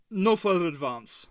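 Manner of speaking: shaky command. A narrator's reading. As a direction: hammer home "No further advance" as an order. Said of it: "a shaky version of his old commanding tone".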